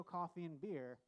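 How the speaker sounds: ordinary talk, nothing marked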